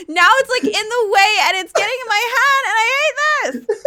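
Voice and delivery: put-on high, loud laughing valley girl whine. Streamer plays up valley girl while fighting her tablet, high laughing whine. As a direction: ham up the valley girl complaint. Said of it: "Full valley girl".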